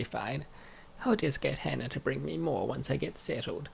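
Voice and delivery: ordinary talk, nothing marked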